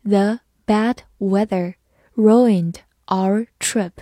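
"The bad weather ruined our trip" slowly, word by word; slow reading. Shown as a demonstration. Teacher demonstrates "The bad weather ruined our trip" to learners, slow reading. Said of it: "慢慢来读".

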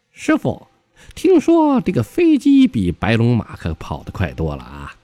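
high, excitable monkey-disciple voice; Sun Wukong. Acted. Monkey disciple suggests a plane to his master, high and eager. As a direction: pipe up eagerly in a put-on high voice. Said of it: "这猴子就说了".

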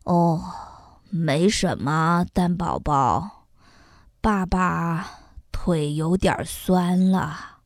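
low, soft, weary dad voice; tired father. A narrator's reading. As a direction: play down the ache, worn out. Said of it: "鸡爸爸累坏了". (疲惫低沉的爸爸声音)

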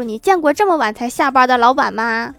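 loud acted-out outburst; indignant, loud rhetorical question; angry scolding. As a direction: snap back loudly, as if cursing someone out. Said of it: "然后我哥破口大骂".

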